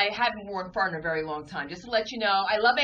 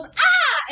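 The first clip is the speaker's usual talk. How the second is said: very high, loud shriek; nurse's scream; 9 dB louder than usual. Retold or imitated. Nurse walking in on it, a very high loud shriek. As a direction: shriek in sudden fright. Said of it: "the little Filipino nurse goes".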